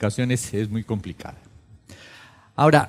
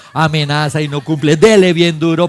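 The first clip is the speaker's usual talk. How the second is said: louder, higher, laughing through it; laughing loud exhortation; 4 semitones higher, 8 dB louder than usual. Real, not acted. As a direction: push the point laughing. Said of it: "preaching loudly while laughing". (exhortación fuerte entre risas)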